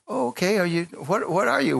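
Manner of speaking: asks, puzzled and a bit surprised; surprised girl. Retold or imitated.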